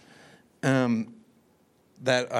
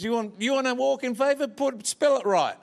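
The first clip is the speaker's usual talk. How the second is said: higher, playful telling-off; mock scolding, higher pitched; mock scolding Americans; 10 semitones higher than usual. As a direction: tease Americans like a cheeky preacher. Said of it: "I say to Americans".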